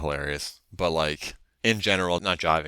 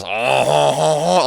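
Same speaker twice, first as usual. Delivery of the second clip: a hammed-up, higher and louder "oh, oh" laugh like a faux French frog; mock French laugh; 9 semitones higher, 10 dB louder, slower than usual. Imitating the French frog character's laugh for a friend, mock laugh. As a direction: imitate the cowardly frog's silly French chuckle. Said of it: "he does, like, that kind of a laugh".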